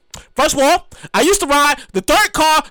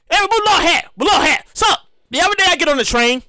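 normal talking voice

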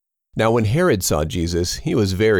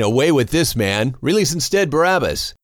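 mild crowd outcry; 7 semitones higher than usual. Acted. Crowd crying out to Pilate for Barabbas, mild raised outcry. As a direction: call out for Barabbas, pitched up but restrained. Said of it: "they all cried out together".

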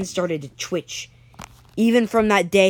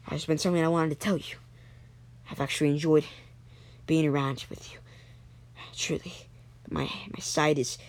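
soft, breathy, halting last words; dying words; 2 semitones lower, 8 dB quieter than usual. Acted. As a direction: force out a quiet farewell with failing breath. Dying friend's last words to Kenny.

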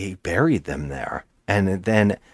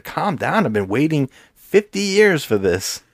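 higher, impatient "Calm down"; mock excited insistence; 8 semitones higher than usual. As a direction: brush off the calm-down, too thrilled to wait. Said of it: "He's like".

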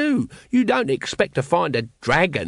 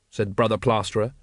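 ordinary delivery, nothing marked

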